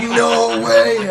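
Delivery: loud, high cry of disbelief. Retold or imitated. Told Stevie just left, loud high disbelief. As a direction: blurt it out, stunned. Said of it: "I was sitting there like".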